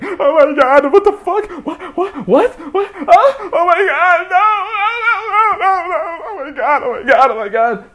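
very high, loud panicked wailing; hammed-up shrieking panic, half crying; freaking out. Man stuck in the shower discovering his mishap, hammed-up freak-out. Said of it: "Now I'm fucking freaking out".

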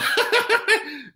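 high giggling burst; real laugh. Real, not acted. Poet tickled by Brother Jamie's question, real laugh.

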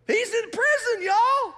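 very high, loud held cry; crying it out to the church; preaching excitement. Acted. Preacher driving home the point.

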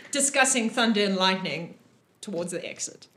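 ordinary talk, nothing marked

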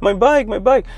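high whiny imitation of a crying child; hammed-up child wailing over a lost bike; mock crying kid. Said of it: "I started crying".

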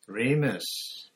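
squeaked. Acted. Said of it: "Pettigrew squeaked".

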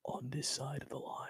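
soft breathy whisper. Real, not acted. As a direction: whisper it secretly. Whispered aside.

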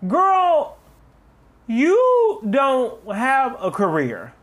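high, slow drawn-out shade; shady clapback. Retold or imitated. Clapping back at a rude commenter, high slow sass. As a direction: stretch it out, pure sass. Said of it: "'cause I was like".

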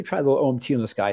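ordinary delivery

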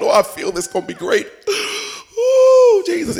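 mock ecstatic prayer.

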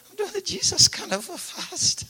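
high, fading voice of a man near death from fasting; feeble dying man. Retold or imitated. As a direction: croak it out as if at death's door. Weak dying Fire answers.

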